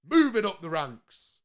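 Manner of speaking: slow, drawn-out Moving up the ranks like a show host; dramatic announcement. Shown as a demonstration. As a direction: savour every word of Moving up the ranks, slowly. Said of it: "repeats the phrase differently".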